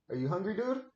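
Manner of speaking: high, loud playful dad voice. Real, not acted. Dad playfully greets his son.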